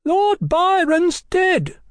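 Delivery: very high, slow yell; shrill, drawn-out announcement; boy yelling. A narrator's reading. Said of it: "the boy yelled".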